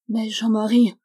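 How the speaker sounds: plain reading voice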